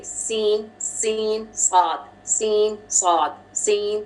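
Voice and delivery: सीन light, सॉद heavy and low; seen and saad contrast. Shown as a demonstration. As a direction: alternate सीन and सॉद to show the difference. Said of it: "same sound आपको लग रहा है".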